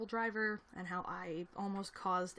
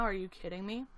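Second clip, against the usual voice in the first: ordinary talk, nothing marked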